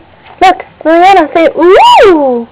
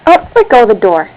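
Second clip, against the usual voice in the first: normal talking voice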